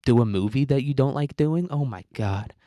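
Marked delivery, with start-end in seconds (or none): none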